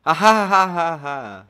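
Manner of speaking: slow, spoken-out fake ha ha; deadpan mock laughter at a joke; sarcastic laugh. Player mocking the knight's joke with a flat fake laugh. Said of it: "Funny guy you are".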